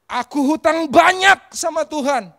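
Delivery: loud, high, slow cry to God; impassioned confession. Retold or imitated. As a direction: declare it at full force, stretching it out. Acted confession to God, repeated emphatically.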